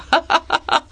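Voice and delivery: high, put-on laugh; scornful acted laugh. A narrator's reading. Minister scoffing at the comedy round, acted scornful laugh. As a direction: laugh mockingly in character.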